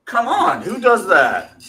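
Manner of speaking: loud, high, drawn-out incredulity; mock disbelief. Real, not acted. Hyping refillable popcorn tins to co-host, loud disbelief. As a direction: exclaim it in big, high disbelief.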